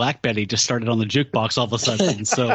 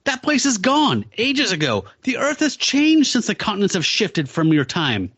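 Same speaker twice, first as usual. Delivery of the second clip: Morbius voice, high; 8 semitones higher than usual. Retold or imitated. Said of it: "And Morbius is like".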